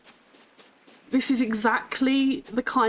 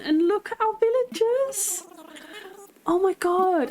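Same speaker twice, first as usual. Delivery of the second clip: much higher, giddy excited voice; overjoyed gamer seeing her perfect island; thrilled disbelief; 10 semitones higher than usual. Real, not acted. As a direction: bubble over with joy. Finds her dream map.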